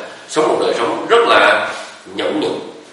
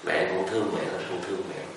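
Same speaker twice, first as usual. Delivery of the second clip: much softer, fading echo; faint echo; 3 semitones lower, 13 dB quieter than usual. Retold or imitated. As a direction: let it come back faint and far. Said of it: "trong rừng cũng có tiếng giận lại". (tiếng vọng nhỏ dần từ trong rừng)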